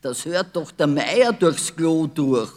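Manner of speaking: loud, exasperated scolding; mother shouting at the noisy pair; scolding call. A narrator's reading. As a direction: tell them off loudly. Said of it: "rief sie".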